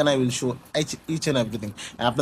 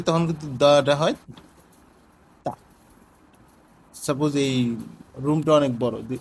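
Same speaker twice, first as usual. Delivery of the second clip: ordinary talk, nothing marked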